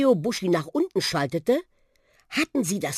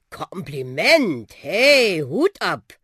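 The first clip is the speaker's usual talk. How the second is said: puffing talking bus, swooping pitch with drawn-out words; schnaufender Buschi; 5 dB louder, slower than usual. Acted. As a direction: huff out showy praise as a bus. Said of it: "Schnaufte der Buschi". (schnaufender, angeberischer Bus)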